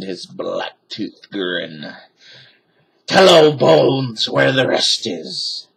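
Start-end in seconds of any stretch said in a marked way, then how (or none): 3.1-5.7 pirate growl. A narrator's reading.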